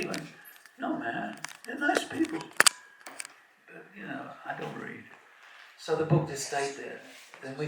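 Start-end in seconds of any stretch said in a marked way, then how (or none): 0.8-5.0 high Bronx voice, offhand; Bronx accent. Retold or imitated. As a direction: play the girl's Bronx dismissal. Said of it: "with a Bronx accent".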